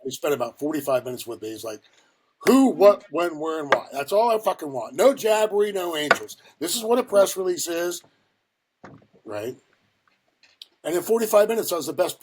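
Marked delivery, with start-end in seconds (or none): 2.4-8.0 loud, emphatic boss laying down rules; retold impatient lecture, punched key words; forceful drilling. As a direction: hammer the lesson into the rookie. Boss forcefully drills rookie on writing.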